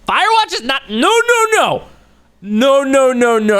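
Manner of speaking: high, loud string of protest; emphatic objection. Real, not acted. Streamer rejecting Firewatch as a cozy game, loud high protest. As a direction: shout it down, pitch leaping up.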